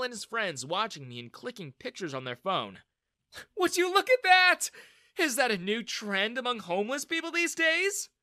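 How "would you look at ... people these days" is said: high, loud mocking jeer; sneering. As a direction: sneer down at him. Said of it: "Daniel sneered".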